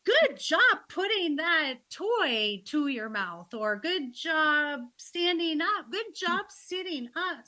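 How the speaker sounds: put-on high, slow, bright praise for a baby; cheery baby praise. Shown as a demonstration. Grandma cheering baby on with slow, high praise. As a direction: coo each little win at the baby. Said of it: "we've been instructed to say".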